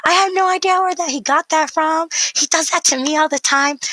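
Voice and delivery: high thrilled agreement; excited dad. Retold or imitated. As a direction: gush like a dad who just lit up. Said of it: "lit up and was like".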